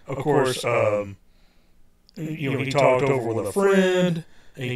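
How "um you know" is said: ordinary talk, nothing marked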